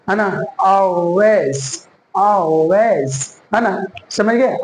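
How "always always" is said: Always drawn out as "aw-wez", L hidden; slow Always, rounded first vowel, falling at the end; dark L demo. Shown as a demonstration. Teacher models it for learners, dark L demo. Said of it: "कैसे बोलेंगे".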